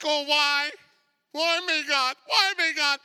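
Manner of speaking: high, loud self-pitying plea; hammed-up wail; mock questioning. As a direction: imitate a complainer crying up to God. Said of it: "Do they ever ask".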